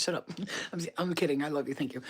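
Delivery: normal talking voice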